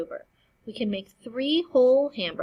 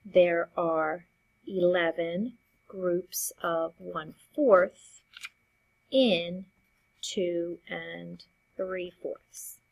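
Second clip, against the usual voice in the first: slow, deliberate reading; much slower than usual. Shown as a demonstration. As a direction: spell it out slowly, word by word. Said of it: "our number sentence should read".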